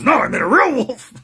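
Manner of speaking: loud, high exasperated-style movie quote; acted comic protest. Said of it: "He goes".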